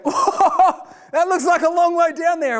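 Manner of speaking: high, laughing nervous gasp at the height; half-laughing fright looking down; nervous whoa. Retold or imitated. Glancing down from the crane, a high nervous laugh.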